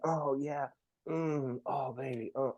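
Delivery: soft, understated mock moaning; mock sex groans. Retelling sex to host, soft mock groaning. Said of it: "I'm making loud groaning noises".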